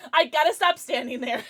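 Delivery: normal talking voice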